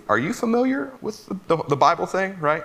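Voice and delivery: high mock know-it-all quizzing; theology bro. Martha quizzes Jesus like a smug theology bro. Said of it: "being a theology bro".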